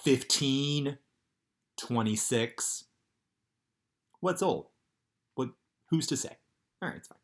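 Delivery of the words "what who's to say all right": plain reading voice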